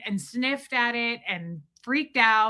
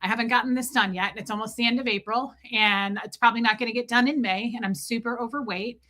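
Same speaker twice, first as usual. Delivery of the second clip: fast self-pitying rant; faster than usual. Retold or imitated. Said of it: "I was like".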